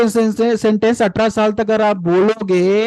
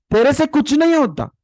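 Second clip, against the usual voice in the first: much higher, contemptuous jab; scornful put-down; 8 semitones higher than usual. Shown as a demonstration. A parent scornfully belittling a struggling child. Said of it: "सबसे गंदा negative statement".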